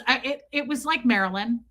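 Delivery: normal talking voice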